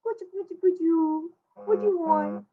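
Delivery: put-on high, cooing baby-talk voice. Shown as a demonstration. Parent cooing at an eight-month-old in baby talk. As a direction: coo it up high like to an infant. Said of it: "Now that is baby talk".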